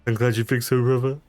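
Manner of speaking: put-on voice addressing a brother; tried-out character voice. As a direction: try on a character's voice. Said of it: "Is that how he talks?".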